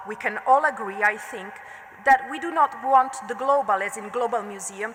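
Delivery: ordinary delivery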